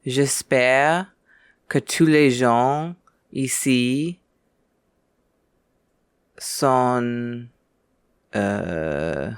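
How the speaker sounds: slow French, groping for each word with long gaps; halting American-accented French. Shown as a demonstration. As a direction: attempt French haltingly, pitch lifted. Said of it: "trying and failing to speak French well".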